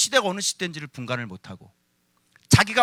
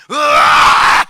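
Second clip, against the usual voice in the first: 크게 으르렁대듯 악쓰는 흉내; 잔소리에 맞서 길게 고함치기; 폭발하는 고함 흉내; 18 dB louder, much slower than usual. Retold or imitated. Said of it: "했어, 안 했어?". (맞서 악쓰는 소리)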